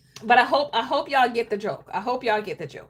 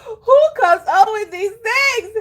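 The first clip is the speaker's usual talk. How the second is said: louder, higher, slower tearful laugh; crying with laughter; 6 semitones higher, 5 dB louder than usual. Real, not acted.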